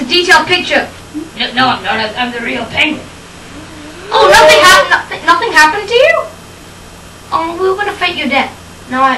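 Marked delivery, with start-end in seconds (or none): none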